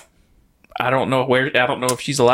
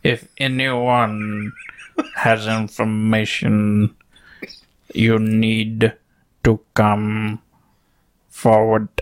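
halting delivery with long pauses; endlessly slow impression; much slower than usual. Podcaster mimicking a teacher questioning students, endlessly slow. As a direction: leave each phrase hanging before the next. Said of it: "graduated by the time he got done talking".

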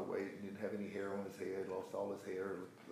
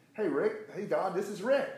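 bright burst of energy, much higher and louder; energetic; 11 semitones higher, 9 dB louder than usual. Retold or imitated. A friend given up for dead calls back, full of energy. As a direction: greet him bursting with new life. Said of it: "he had energy in his voice".

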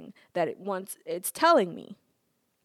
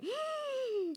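high mock gasp; 9 dB quieter than usual. Her own reaction.

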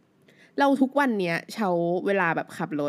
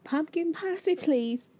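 high, very slow film-heroine English; imitating Cho Chang; 8 semitones higher, much slower than usual. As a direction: lightly mimic a Harry Potter heroine's line, much higher and drawn out. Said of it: "เขาก็จะพูดแบบ".

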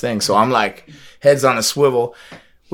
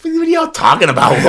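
high, loud disbelief; baffled question; 5 dB louder than usual. Retold or imitated. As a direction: demand to know what they mean. Confused guest to the waiters.

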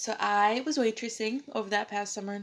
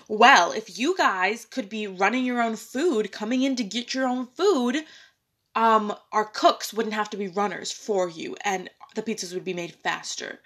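louder, mock-furious retort; imitated angry boss; 2 semitones higher, 7 dB louder than usual. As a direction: snap back like an irritated owner, retold. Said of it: "He was furious. He was really angry".

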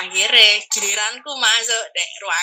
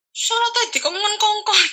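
menggerutu sambil tertawa; 10 semitones higher than usual. Retold or imitated.

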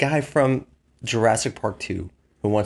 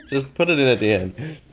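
laughing; 6 semitones higher than usual. Real, not acted. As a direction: talk through the laughter.

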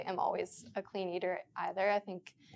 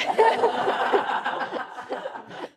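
breathy high laugh; 13 semitones higher, 9 dB louder than usual. Real, not acted. Laughs at cheese shoutout.

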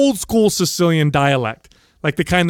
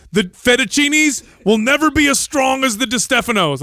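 put-on higher, grand old grandfather boast; mock loud proclamation; 8 semitones higher than usual. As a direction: proclaim it like a tipsy patriarch on a chair. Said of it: "makes this loud proclaimment".

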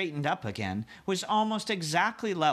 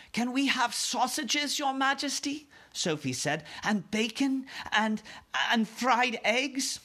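put-on excited little girl; 11 semitones higher than usual.

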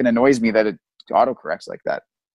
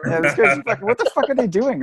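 mock eavesdropping device; 11 semitones higher than usual. Voicing the speaker listening in.

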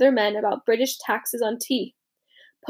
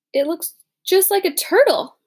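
brightly; 6 semitones higher, 4 dB louder than usual. Acted. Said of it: "I said brightly".

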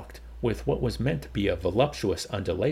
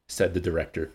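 plain reading voice

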